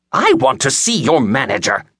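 put-on high, indignant old-woman demand; incensed old woman. A narrator's reading. Old customer to bookstore clerk, incensed. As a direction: demand it in a high-pitched, outraged voice. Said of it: "the incensed old woman said".

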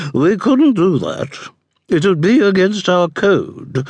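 high, imperious matron voice; haughty dowager duchess. A narrator's reading. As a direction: ask like a disdainful grande dame.

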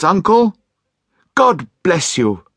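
high, loud joyful greeting to his uncle; happy cry. Acted. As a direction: beam it out to the old man. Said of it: "cried a happy voice".

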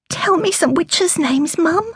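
put-on a young girl begs her mother, in a high child voice; little girl. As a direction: ask like a curious young girl. Young daughter asks her mother.

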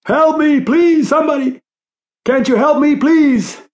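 high, desperate scream for help; agonised high-pitched pleading; tortured cry. A narrator's reading. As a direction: cry out in torment, begging. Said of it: "The tortured voice cried out".

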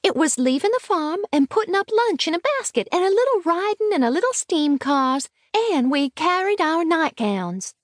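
put-on very high little-girl voice, rattling off her proof; excited small girl, pitched far up; eager, wilful child. A narrator's reading. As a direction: argue your case as a headstrong child. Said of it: "said the child eagerly and wilfully".

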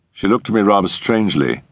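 usual speaking voice

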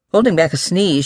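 plain reading voice

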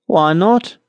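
high, lilting reply; farmer answering. A narrator's reading. Little farmer answering the miller, high lilting voice. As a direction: answer with pitch lifted high. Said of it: "answered the little farmer".

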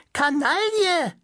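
very high, slow, prim spinster shriek; old maid's cry. Acted. The spinster puppet scolds the guard in a high, drawn-out shriek. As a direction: stretch it out in an outraged prim squeal. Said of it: "schloss sich das Burgfräulein an".

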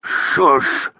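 embarrassed hushing husband; a pained little shush; unhappy shushing. A narrator's reading. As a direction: shush her, mildly unhappy. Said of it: "said her husband unhappily".

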